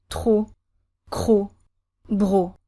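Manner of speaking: "tro, cro, bro" with a strong R right after each consonant; strong French R. Shown as a demonstration. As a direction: drill "tro, cro, bro" apart, pushing the R. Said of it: "The R here is quite strong".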